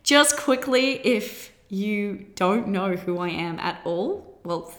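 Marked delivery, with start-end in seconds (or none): none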